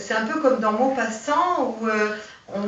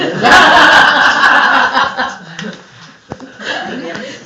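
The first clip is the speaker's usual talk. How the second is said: embarrassed laugh; 3 semitones higher, 13 dB louder than usual. Real, not acted. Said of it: "tu feras comme tu peux".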